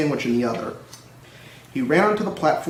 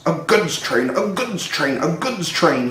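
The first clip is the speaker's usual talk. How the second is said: grumbling; 3 semitones higher, slower than usual. A narrator's reading. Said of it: "he grumbled".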